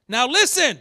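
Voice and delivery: loud, sudden call to attention; sharp rebuke. Acted. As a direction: rebuke them with a loud command. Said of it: "This is a rebuke".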